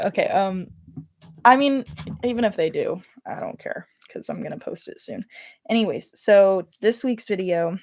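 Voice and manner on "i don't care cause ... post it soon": softly whispered, half to herself; whispered aside. Real, not acted. To herself while setting up the video, whispered aside. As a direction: whisper it under your breath, off-mic.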